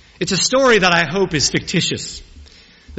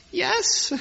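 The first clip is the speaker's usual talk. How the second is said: tiny, much higher squeak of a "Yes."; little squeaky voice; 4 dB quieter than usual. Retold or imitated. Paralyzed groom answering the minister, little squeaky voice. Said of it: "in a little squeaky voice".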